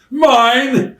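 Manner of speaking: high, loud possessive yell; kid fighting over toy. Acted. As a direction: yell it like a squabbling child. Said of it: "when kids fight over a toy".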